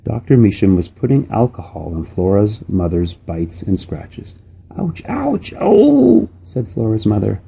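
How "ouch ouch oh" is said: high-pitched ouches swelling to a loud oh; pained yelping. A narrator's reading. Mother getting alcohol on her bites and scratches, pained yelping. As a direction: yelp in pain, drawing out the last cry.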